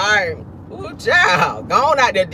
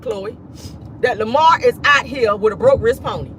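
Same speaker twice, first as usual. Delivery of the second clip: normal talking voice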